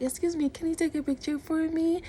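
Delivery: high-pitched, sweet retold ask; retold courteous high-voiced request; polite request. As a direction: ask a stranger nicely, voice way up.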